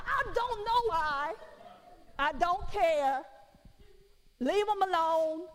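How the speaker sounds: drawn-out I don't care, leave it be; dismissive. Retold or imitated. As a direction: shrug the question off. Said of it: "And I tell the person".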